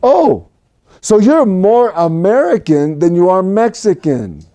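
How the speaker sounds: ordinary talk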